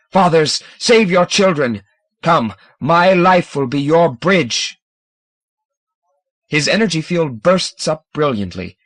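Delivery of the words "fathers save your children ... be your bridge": loud, higher rallying cry; rally the fathers like a sacrifice; thundering call. A narrator's reading. Scomber calling the fathers to follow, loud. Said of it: "he thunders".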